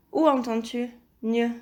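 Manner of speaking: ordinary talk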